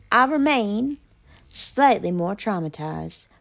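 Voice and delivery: ordinary delivery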